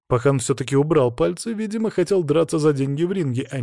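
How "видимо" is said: ordinary delivery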